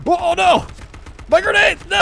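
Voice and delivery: very high, very loud panicked cry; panicked yell. Real, not acted. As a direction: scream at your own grenade. Own grenade goes wrong.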